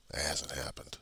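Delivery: soft unvoiced whisper; real hushed confession. Real, not acted. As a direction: let it slip out under the breath. Admitting fear of violence.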